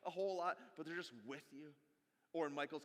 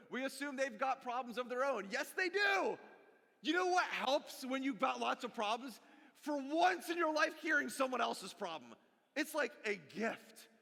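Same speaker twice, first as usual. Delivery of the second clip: loud, higher, emphatic sermon delivery; preaching at full force; 6 semitones higher, 5 dB louder than usual. Real, not acted. Pastor rallying the congregation, preaching loud and emphatic. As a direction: drive it home to the congregation.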